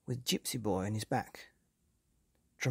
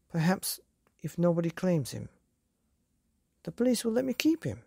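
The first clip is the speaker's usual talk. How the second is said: put-on higher hopeful boy; eager young boy; 5 semitones higher, 3 dB louder than usual. A narrator's reading. Hopeful boy asks father.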